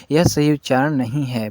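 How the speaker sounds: normal talking voice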